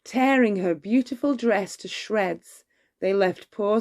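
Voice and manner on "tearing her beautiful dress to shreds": usual speaking voice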